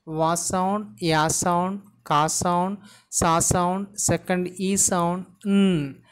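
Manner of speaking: each sound of "vaccine" named in turn; sound-by-sound pronunciation. Shown as a demonstration. Teacher teaching the pronunciation of vaccine, sound by sound. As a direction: break it up evenly: "wa sound, ya sound". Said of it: "The pronunciation of the word is".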